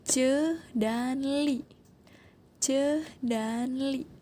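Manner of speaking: Ce dan li, drawn out and slow; slow syllables. Shown as a demonstration. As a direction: spell out the syllables slowly. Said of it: "suku katanya jadi gini".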